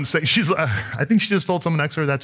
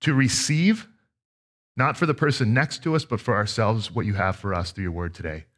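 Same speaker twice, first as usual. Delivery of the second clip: prayer; 3 semitones lower than usual. Acted. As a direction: pray over the congregation gently. Opening prayer before sermon.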